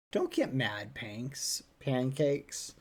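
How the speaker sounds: normal talking voice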